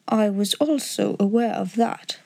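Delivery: ordinary delivery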